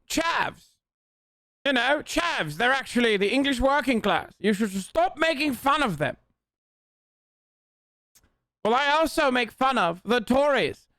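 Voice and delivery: high, put-on British accent; mock British scold. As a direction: lecture in an exaggerated high British voice. Voices scolding British critic.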